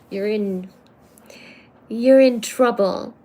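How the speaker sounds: usual speaking voice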